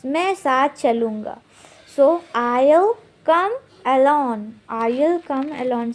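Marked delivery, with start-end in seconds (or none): 2.0-5.9 slow, carefully spaced teacher model; slow model phrase. Shown as a demonstration. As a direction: stretch each word for the learner. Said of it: "come along use करते हैं". (धीमे, साफ़ उच्चारण में)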